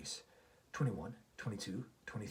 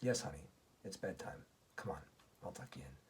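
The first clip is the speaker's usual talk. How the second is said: softer, lower, tender reassurance; caring; 5 semitones lower, 6 dB quieter than usual. A narrator's reading. Daddy tucking Reese in, caring tone. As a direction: gently coax a child to bed. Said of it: "says in a caring tone".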